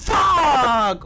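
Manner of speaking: high, loud burst of shock; real startled shout. Real, not acted. Player falls off in the game, startled shout. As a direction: yell it out as it goes wrong.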